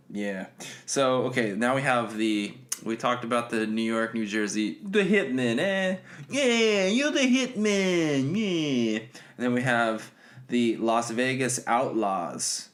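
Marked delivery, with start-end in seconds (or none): 4.8-9.0 high, hammed-up mobster call; bad gangster voice. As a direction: stretch it out like a cartoon wiseguy. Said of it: "my bad gangster voice".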